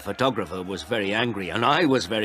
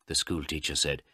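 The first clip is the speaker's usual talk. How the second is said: ordinary delivery, nothing marked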